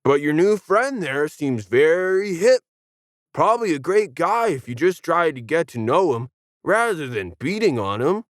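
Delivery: easygoing peacemaker; lightly voiced surfer dude; laid-back dude. Leaf talks.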